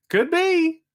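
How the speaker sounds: teasing falsetto could be; mock-innocent high voice; high sly squeak. Real, not acted. Sly agreement.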